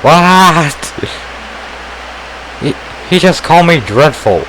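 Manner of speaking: high, shocked what; outraged disbelief; indignant surprise. Acted. Kid insults her accent.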